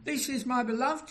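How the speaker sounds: high, emphatic heavenly declaration; preacher voicing God with fervour; outspoken love. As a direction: speak out love as a proud divine Father. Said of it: "He has to speak out his love".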